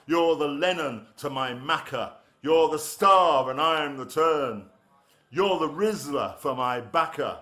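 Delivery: rhythmic, emphatic rhyming couplets; love poem recital. Acted. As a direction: drive each couplet home on the beat.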